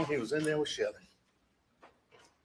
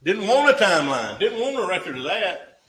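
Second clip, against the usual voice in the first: forceful accusation; 5 semitones higher, 10 dB louder than usual. Acted. Closing argument, pointed accusation.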